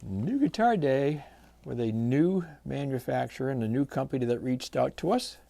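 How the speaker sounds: ordinary delivery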